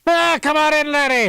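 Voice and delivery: over-the-top stage Irish welcome; Irish accent. Pretend. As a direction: greet them like a jolly Irish barman. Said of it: "That's an Irish accent".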